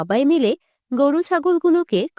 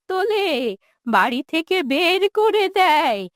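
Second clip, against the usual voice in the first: bitter, quavering lament of a wronged mother; wounded old woman, tearful and resentful; aggrieved old mother; 2 semitones higher, slower than usual. Acted. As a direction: pour out the old grievance, stretching phrase ends.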